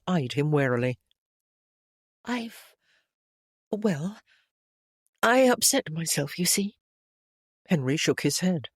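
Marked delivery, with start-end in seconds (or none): 2.2-6.8 put-on higher, earnest voice for a flustered young woman; young lady Jane. A narrator's reading. Jane to Henry, apologising for avoiding him, higher and earnest. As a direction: lift into Jane's voice, eager to reassure.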